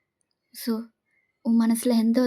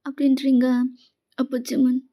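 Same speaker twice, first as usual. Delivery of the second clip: usual speaking voice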